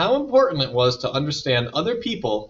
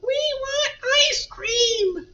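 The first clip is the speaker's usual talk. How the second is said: screaming little brothers; slower than usual. A narrator's reading. Brothers run around during homework demanding a treat, high mock screaming. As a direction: squeal it as clamoring little kids. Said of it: "his brothers ran around screaming".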